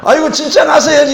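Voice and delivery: preacher recalling his panic, loud and high; anxious exclaim. Retold or imitated. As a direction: cry out in comic panic. Panicked inner thought.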